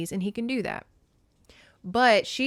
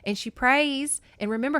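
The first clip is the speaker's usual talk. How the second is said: ordinary delivery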